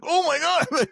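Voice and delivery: sudden high-pitched oh my God; stunned cry of oh my God; shocked gasp. Retold or imitated. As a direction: blurt it out in disbelief, pitch leaping. Shocked by the harsh dressing down.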